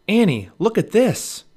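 high, loud excited call. A narrator's reading. Jack calls Annie to see his find, loud. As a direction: call to someone across the hill. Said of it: "Jack called".

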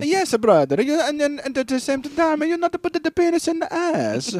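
very high mock Italian voice; Italian accent. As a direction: keep riffing as the Italian guy. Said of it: "Italian accent".

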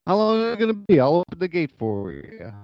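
put-on a little Italian-American accent, starting high. Garden keeper offering filmmakers the gate, light Italian voice. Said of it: "using my Italian voice a little bit".

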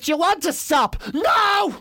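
high-pitched exasperated outburst; mock exasperation. Couple arguing over whether to stop, acted exasperation. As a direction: climb high, then cry out the refusal.

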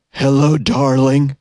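slow, husky, creepy caller. Acted. Prank caller greeting the barber, creepy husky voice. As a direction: draw it out in a husky put-on voice. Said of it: "husky voice asks".

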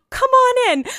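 flustered fake-bright welcome; greeting the handsome cop, high and flustered. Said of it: "I go".

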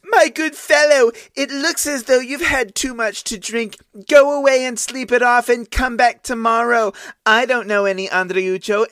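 high falsetto of a scornful maid; mocking maidservant. A narrator's reading. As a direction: talk down to him like a drunk. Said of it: "And her answer was".

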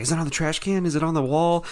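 eager questions, mimicked high; pumped-up kid. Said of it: "he was so pumped".

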